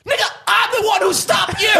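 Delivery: very high outraged shout; high, exasperated reenacted yell; retold screaming. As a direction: yell it in hammed-up indignation, voice way up. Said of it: "so many screaming".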